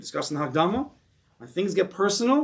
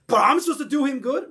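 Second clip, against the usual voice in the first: high, loud, disbelieving complaint; indignant protest; 10 semitones higher, 5 dB louder than usual. Retold or imitated. Teacher voicing the mind's indignant protest, higher and louder. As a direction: blurt it out as if the idea is absurd.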